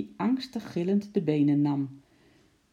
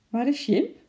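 higher, louder puzzled question; wondering; 8 semitones higher, 4 dB louder than usual. A narrator's reading. Henkie comes back and asks, puzzled, where Sjimp is. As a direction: ask it surprised and puzzled. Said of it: "vroeg hij verwonderd".

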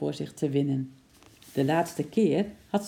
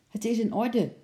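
higher-pitched, pleased announcement; happy; 7 semitones higher than usual. A narrator's reading. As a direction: report good news with a touch of joy. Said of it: "zei hij blij".

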